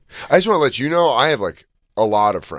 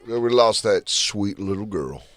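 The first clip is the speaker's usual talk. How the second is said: slow, lightly done country drawl; a hint of a slow country accent. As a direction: read it like a slow country singer. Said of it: "he says it in a country voice".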